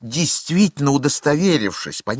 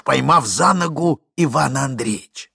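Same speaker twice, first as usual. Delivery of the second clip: ordinary delivery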